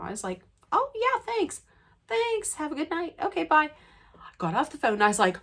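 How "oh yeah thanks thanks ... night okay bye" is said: high, gushing thank-yous; giddy goodbye. Retold or imitated. Fan saying goodbye to the star, overjoyed. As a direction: sign off trying to sound cool but thrilled.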